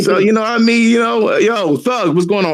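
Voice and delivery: high, lightly put-on gamer voice; mock fanboy voice. Mocking a fanboy greeting the streamer, high voice. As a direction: imitate a fan gushing to a streamer.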